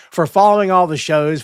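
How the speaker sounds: normal talking voice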